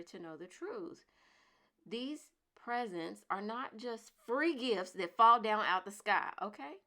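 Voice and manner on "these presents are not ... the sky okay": scolding lecture on gifts; loud emphatic lecture. Real, not acted. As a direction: drive the point home hard. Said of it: "lecturing viewers firmly".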